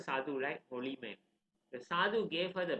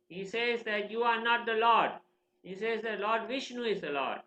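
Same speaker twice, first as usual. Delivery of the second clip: high, emphatic mock report; scandalised complaint; 6 semitones higher, 5 dB louder than usual. Teachers complaining to the father about his son, emphatic. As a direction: tattle with raised pitch and force.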